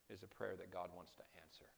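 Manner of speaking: soft, fast whispered close of the point. Acted. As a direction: drop to a whisper to draw them in. Sermon point dropped to whisper.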